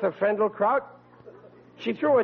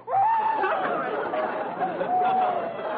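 high goofy laugh. Acted. Riley pleased with his own joke, goofy laugh. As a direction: burst into a silly laugh.

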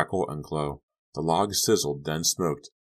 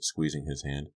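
ordinary delivery

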